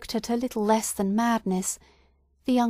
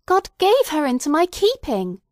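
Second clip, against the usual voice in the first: higher and louder, insisting; mother repeats her claim, higher and louder; repeated, louder; 7 semitones higher, 4 dB louder than usual. A narrator's reading. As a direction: repeat it with more force. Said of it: "repeated Hester Prynne".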